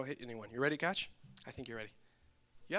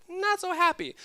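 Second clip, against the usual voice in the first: higher, sing-song not that happy; mock sad punchline; 12 semitones higher, 3 dB louder than usual. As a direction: toss it up high like a refrain. Repeated punchline refrain.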